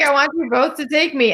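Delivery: far-off sultry plea; sultry heroine voice. Retold or imitated. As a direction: play the lusty heroine. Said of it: "she's like".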